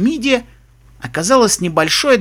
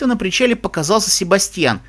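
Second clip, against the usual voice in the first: usual speaking voice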